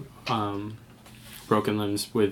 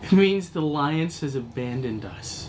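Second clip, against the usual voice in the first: higher, desperate outburst; panicked survivor; 6 semitones higher than usual. Acted. Survivor to the medic about the Alliance, panicked. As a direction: blurt it out in fear and despair.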